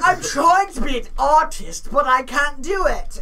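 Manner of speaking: mock British struggling artist; British accent. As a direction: do a hammy, drawn-out British accent. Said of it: "He's British".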